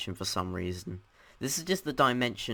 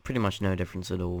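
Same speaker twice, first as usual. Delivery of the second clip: ordinary talk, nothing marked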